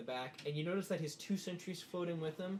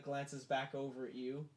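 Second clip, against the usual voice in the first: ordinary talk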